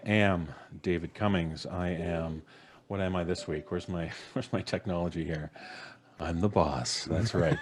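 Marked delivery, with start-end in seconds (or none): none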